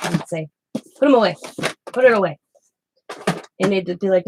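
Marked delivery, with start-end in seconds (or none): 0.7-2.4 a hint of a stern old man, louder and higher; firm command from a stern grandpa; stern grandfather. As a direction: grandfather ordering the doctor to put the chart away, sternly. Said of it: "He'd have that tone".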